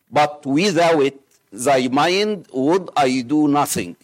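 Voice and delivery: ordinary talk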